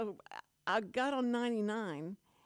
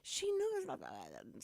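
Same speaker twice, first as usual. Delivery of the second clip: much higher, slowing to a drawn-out groan; upset granddaughter; 12 semitones higher, slower than usual. Retold or imitated. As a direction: imitate the girl's shocked, sulky whine. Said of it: "you hear her go".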